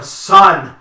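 slow, loud, booming announcement; draft announcer voice. Pretend. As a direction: announce it like a draft pick reveal. Mock draft-day announcement.

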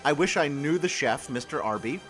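ordinary talk, nothing marked

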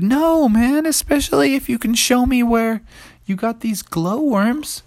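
high, eager dinosaur buddy; high-pitched pleading assurance; eager T-Rex. Acted. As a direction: promise it in a high, keen voice. T-Rex reassures the kids.

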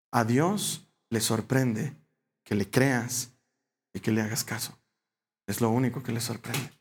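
hushed heartfelt preaching; earnest sermon. Acted. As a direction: lean in and press the truth gently. Preaching softly, slow.

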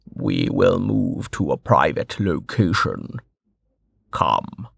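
low and very slow; solemn Westbridge. A narrator's reading. Westbridge announcing the moment, low and slow. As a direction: weigh every word.

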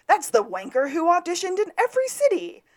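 high, loud acted insult; Nigel Saint Nigel. Podcaster quoting a TV judge's insult, high mock put-down. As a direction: pitch the insult up high and sneer it. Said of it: "Nigel Saint Nigel has his eighteenth insult".

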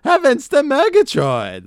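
Ed Wynn impression. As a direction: cry it out like a cartoon lion. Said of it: "Ed Wynn's like".

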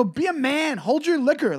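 high-pitched angry scolding; retold screaming. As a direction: replay berating a drunk heckler. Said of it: "I just screamed at this dude".

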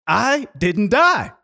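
high, loud, drawn-out exclamation; triumphant realization. Retold or imitated. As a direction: stretch it out in amazed triumph. Same realization repeated in triumph.

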